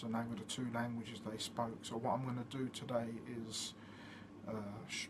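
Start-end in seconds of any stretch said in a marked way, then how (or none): none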